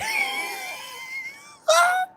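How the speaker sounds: high screaming burst of laughter; shrieking laugh. Real, not acted. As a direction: let out a piercing laughing shriek. Bursts out after the lips line.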